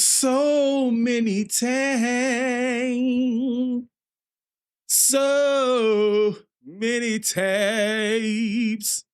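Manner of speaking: high, stretched whine over the tabs; very slow high whine. Acted. Streamer mock-wailing about too many tabs, stretched high. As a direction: wail each word slowly and high. Frustrated drawn out complaint.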